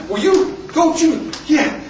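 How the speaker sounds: spluttering, high-pitched protest; acted indignation, stumbling and high; indignant lieutenant. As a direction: defend your colonel in mock outrage. Lieutenant splutters in defense of colonel.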